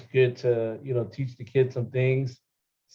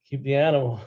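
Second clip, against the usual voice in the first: usual speaking voice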